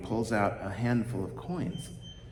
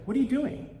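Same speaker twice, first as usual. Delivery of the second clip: lightly voiced baker; 14 semitones higher than usual. Baker confronts the poor man.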